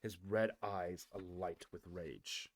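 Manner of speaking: ordinary delivery, nothing marked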